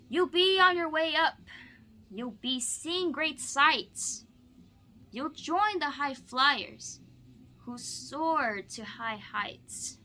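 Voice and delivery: lively, expressive storybook read-aloud; bouncy rhyming picture-book voice; reading to children. Acted. Teacher reads Seuss to student.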